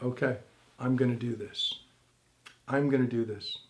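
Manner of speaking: low, soft talking to himself; quiet self-resolve. Retold or imitated.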